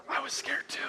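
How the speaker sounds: hushed confession, all breath; mock scared whisper. Preacher admitting fear to the congregation, mock scared whisper. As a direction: whisper it like the frightened boy.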